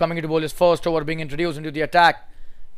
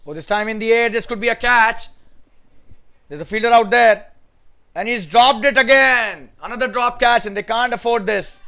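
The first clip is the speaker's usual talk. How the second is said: much higher and louder, rising to a cry at the drop; excited commentary; 8 semitones higher, 5 dB louder than usual. Real, not acted. Commentator sees a catch dropped, excited and dismayed. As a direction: call the catch then groan at the drop.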